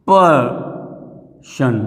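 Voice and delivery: Person drawn out as two parts, पर, then शन; split into syllables. Shown as a demonstration. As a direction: say person very slowly, pausing between पर and शन. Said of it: "ये एक आपका syllable कहलाएगा".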